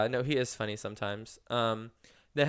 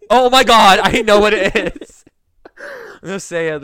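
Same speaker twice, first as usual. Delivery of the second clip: loud, high burst of recognition; excited; 7 semitones higher, 17 dB louder, slower than usual. Real, not acted. Friend guessing the weekly song, loud excited outburst. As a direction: blurt it out thrilled.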